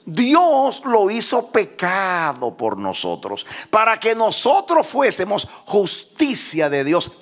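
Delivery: loud, high-pitched preacher's cry; full-force preaching. Real, not acted. Preacher rallying the church to applaud and declare justice, full-force preaching. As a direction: preach it out at full volume, stretching the big words.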